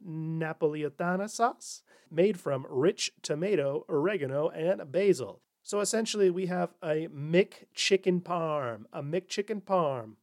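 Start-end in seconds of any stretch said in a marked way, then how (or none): none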